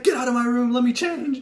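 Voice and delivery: high, loud mock outrage; exasperated yelling. Naked friend demanding privacy from intruder, exasperated yelling. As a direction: yell to get the intruder out. Friend orders him out, acted.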